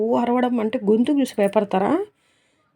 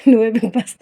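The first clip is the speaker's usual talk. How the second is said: mocking laugh; 4 dB louder than usual. Mocking Ayesha's claim to be Bigg Boss with a loud laugh. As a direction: laugh through the words, loud and teasing.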